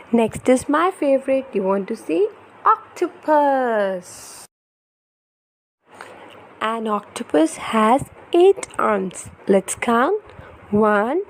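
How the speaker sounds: put-on lively, sing-song storybook reading for a toddler; reading to children. Parent reading an ocean picture book to baby, animated storytelling. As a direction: read it brightly to hold a little one's attention.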